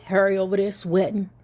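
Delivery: usual speaking voice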